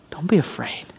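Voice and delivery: hushed reassuring whisper. Acted. Angel soothing Hagar in the desert, whispering. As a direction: whisper it like calming a child.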